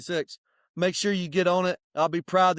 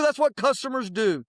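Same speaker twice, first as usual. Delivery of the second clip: ordinary delivery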